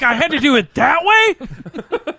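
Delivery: loud, high cry of frustration; swearing in acted disbelief, dragged out; exasperated cursing. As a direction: curse at a home repair gone wrong. Acting out cursing at a repair video.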